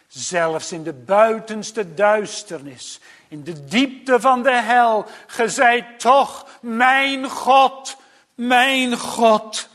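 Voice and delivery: luid en hoog oplopende preekstem; bevlogen preek. Acted. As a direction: preek met aanzwellende kracht. (gedreven prediken, steeds luider en hoger)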